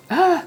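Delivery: sharp high rising exclamation; rising question squeal. Shown as a demonstration. Speaker showing an uptalk rise to the audience. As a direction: shoot the pitch way up like a question. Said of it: "repetitive prosody".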